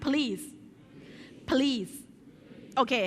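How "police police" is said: "please" as p'LEES, long EE; model pronunciation for repeating. Shown as a demonstration. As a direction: lead the class saying "please" as puh-LEES run together. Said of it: "อ่านพร้อมกัน สาม สี่". (นำอ่าน "please" ว่า "พลีส" ให้พูดตาม)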